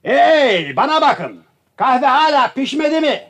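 high, loud impatient demand; shouting. Acted. Guest hollering at servants about his coffee, angry shout. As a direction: yell for the overdue coffee. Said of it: "lütfen bağırmayın".

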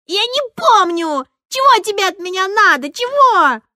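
put-on very high, squealing child's shout; cornered child screaming at the teacher; shrill screaming. A narrator's reading. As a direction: shriek it in a tearful tantrum. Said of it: "визгливым".

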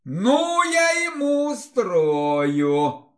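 slow, high vengeful hiss. A narrator's reading. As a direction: hiss out the threat. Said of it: "прошипела девушка".

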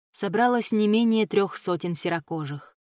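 usual speaking voice

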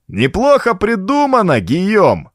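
high, drawn-up mocking call. A narrator's reading. Vulmi taunts his enemies from above, mocking. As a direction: taunt them with exaggerated praise. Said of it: "насмешливый голос Вулми".

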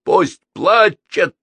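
high, loud, drawn-out roar; slow angry bellow; roared retort. A narrator's reading. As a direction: roar it back defiantly. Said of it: "рявкнул Чаби".

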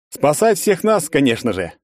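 high, loud heroic declaration; hammed-up heroic movie line; grandiose movie hero. A narrator's reading. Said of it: "в духе старых пафосных фильмов".

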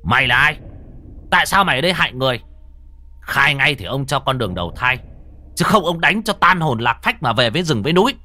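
hissing angrily. Acted. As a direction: bark the threat at the ghost. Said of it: "chỉ cây phất trần mà rít lên".

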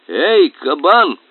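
slow, loud hail; drawn-out call. Acted. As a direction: call out slowly to the boar. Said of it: "крикнул Пахомыч".

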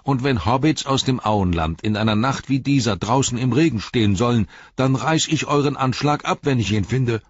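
loud angry hobbit; angry shout. Acted. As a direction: yell it through the rain. Sam threatens the gatekeepers.

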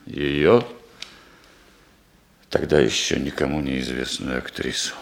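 low, heavy confession with long pauses; sombre, halting admission; subdued confession. Acted. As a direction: confess love quietly, deep in the voice. Broken confession of love, long pauses.

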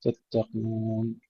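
تتقون said slowly and softly, the doubled T firm and unwhispered; تتقون, careful and slow; voiced firm double T. Shown as a demonstration. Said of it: "التاء المشددة لا نهمسها".

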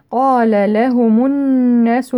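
slow recitation with a long doubled ن in الناس; full ghunna nun. Shown as a demonstration. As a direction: hold the nasal hum on the ن of الناس. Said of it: "نون مشددة غنة أكمل ما تكون".

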